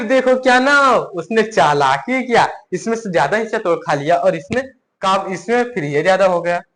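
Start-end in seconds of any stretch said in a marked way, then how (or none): none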